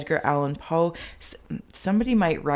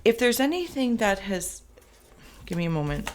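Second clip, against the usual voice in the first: getting emotional; 3 semitones higher than usual. Real, not acted. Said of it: "I'm getting a little emotional".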